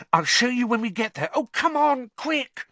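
high, impatient hurry-up; eager urging. Acted. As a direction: hurry them along eagerly. Hermione impatient.

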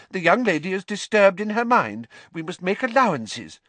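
smooth things over, flustered; flustered minister. Acted. Minister soothing the angry teacher, high and flustered. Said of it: "said Fudge, startled".

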